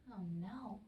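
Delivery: whispered worried aside. Real, not acted. The reader whispers to the listening children, worried.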